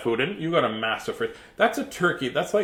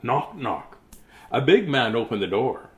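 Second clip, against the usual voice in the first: usual speaking voice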